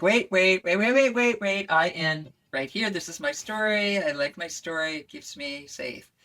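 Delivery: nervous psyche. Acted. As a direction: play a nervous inner voice, urgent, then settling down. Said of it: "the psyche is a little nervous".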